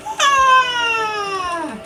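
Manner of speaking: long, high, loud squeal; high-pitched scream. Pretend. Gamer breaking into his video tutorial, high scream. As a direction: let out one sustained high shriek.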